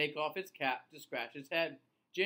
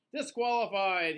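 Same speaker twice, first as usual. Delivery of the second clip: grumbling; 3 semitones higher, 7 dB louder than usual. Acted. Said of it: "he grumbles".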